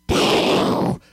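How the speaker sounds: loud, voiceless blast of a shotgun; booming gunshot imitation. Dad's gun firing at ducks, loud imitated blast. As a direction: blow out a big gun bang. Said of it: "he's taking his gun going".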